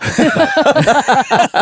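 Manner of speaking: loud high cackle of laughter; shrieking laugh. Real, not acted. Laughing at bowler's look.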